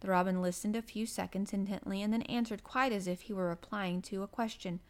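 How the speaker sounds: usual speaking voice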